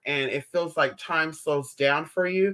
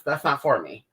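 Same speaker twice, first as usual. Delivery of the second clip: ordinary delivery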